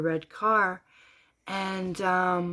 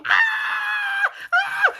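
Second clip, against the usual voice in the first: high, loud mimicked shriek; medium's scream; 8 dB louder than usual. Medium on stage startled by a vision, imitated scream. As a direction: shriek out in two bursts. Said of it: "woman on the stage started screaming".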